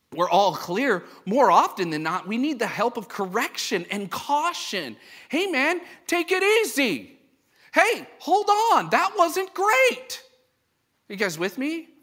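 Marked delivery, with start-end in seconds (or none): 5.3-10.2 much higher, slow, coaxing warning; mock cautioning friend. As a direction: play a buddy gently reining you in. Voicing a friend's gentle caution.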